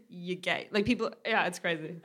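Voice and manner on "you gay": lightly voiced low, quiet character; quiet mock-blunt character; gruff character voice. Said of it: "I don't know what character that was".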